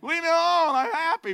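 high, loud whiny protest; begging to be left in heaven; hammed-up stubborn Lazarus; reluctant Lazarus. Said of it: "he's thinking".

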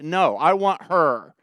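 loud, high, slow refusal; acted-out bratty insistence; defiant son. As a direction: cut dad off with a stubborn demand. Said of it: "without respecting or being accountable to his parents".

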